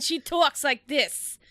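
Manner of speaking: loud, slow mock Brooklyn accent; imitated Brooklyn accent. Host mimicking a friend from Brooklyn, imitated thick Brooklyn accent. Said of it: "really thick Brooklyn accent".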